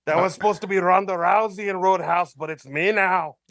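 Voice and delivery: broad comic Irish brogue; Conor McGregor. Acted. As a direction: rant in character with a put-on Irish accent. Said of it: "Do we have Connor on the line".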